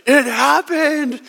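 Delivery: high, slow, wondering cry; amazed joy. Retold or imitated. Son after the winning free throws, awed delight. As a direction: marvel at the win finally coming. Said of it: "And I was like".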